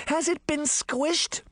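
high, slow, muffled under covers. Acted. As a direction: call out from under a blanket. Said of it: "Daphne's muffled voice came from under the covers".

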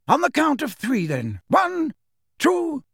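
high, measured count to three. A narrator's reading. Dumbledore counting for the portkey, high and calm.

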